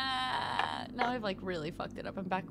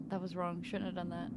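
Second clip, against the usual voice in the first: ordinary talk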